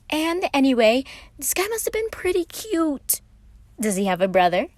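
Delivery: high, loud teasing voice; joking Patton. A narrator's reading. Patton teasing Virgil about Roman, high and playful. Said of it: "Patton joked".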